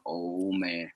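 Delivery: low, very soft sinking groan; sheepish dismay. Retold or imitated. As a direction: let it drop, guilty and hushed. Retold dismay at disappointed older man.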